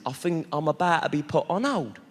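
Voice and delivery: ordinary talk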